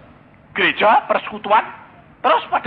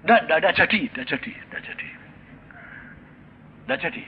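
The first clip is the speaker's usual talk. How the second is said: hasty backing down; 4 dB quieter than usual. Retold or imitated. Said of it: "Aku bilang apa?".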